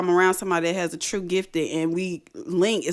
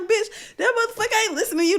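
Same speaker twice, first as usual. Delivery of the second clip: much higher, very fast, laughing; laughing retort; 13 semitones higher, faster than usual. Retold or imitated. Mocking friends ordering the ghost out, fast laughing. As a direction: rattle it off cracking up. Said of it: "And I'm like".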